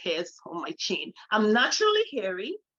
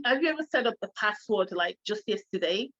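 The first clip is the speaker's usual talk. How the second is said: usual speaking voice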